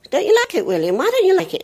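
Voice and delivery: high, loud motherly questioning; high-pitched mock mum asking her little boy; mother's voice. Mother voicing herself to son.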